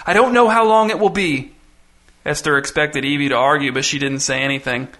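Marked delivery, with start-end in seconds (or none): 0.1-1.5 high, distressed wail held back; on the verge of tears. A narrator's reading. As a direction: let helplessness push the pitch up. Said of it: "on the verge of tears".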